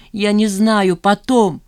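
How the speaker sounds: loud, agitated outburst; distraught brush-off. A narrator's reading. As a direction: cut him off in distress. Woman cutting off the phone call. (громко, в смятении)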